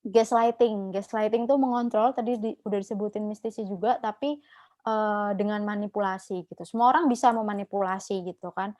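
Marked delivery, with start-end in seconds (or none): none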